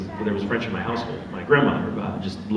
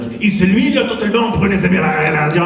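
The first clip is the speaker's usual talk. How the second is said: higher, louder mock French babble; 8 semitones higher, 6 dB louder than usual. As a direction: show off breezy fluent French. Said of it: "and he was like".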